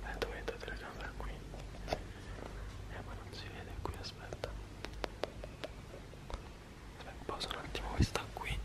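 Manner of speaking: hushed whisper, setting up quietly. Real, not acted. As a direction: whisper so the sleeper stays asleep. Setting camera beside sleeping friend.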